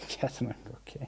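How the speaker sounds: breathy laugh through the words, very soft; laughing whisper. Real, not acted. Repeating his friend's joke while laughing softly. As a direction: giggle it out almost silently. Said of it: "Ca-, ca".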